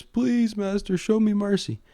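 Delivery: high-pitched imitation of desperate begging; begging Maul. Fallen apprentice begging his master for mercy, hammed-up pleading. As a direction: beg for mercy in a put-on high voice. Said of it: "Maul begs for mercy. He begs and begs".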